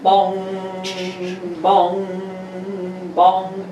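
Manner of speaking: sung bong, bong, bong like a bell; slow sung bell tones; vocal chime sound. Acted. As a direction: ring out each bong like a chime. Radio show chime sound effect.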